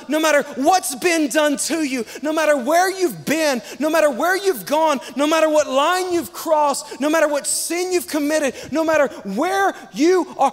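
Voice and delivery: rising, pounding list of no matter; full-force altar call; preaching at full force. Real, not acted. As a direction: preach it big and building.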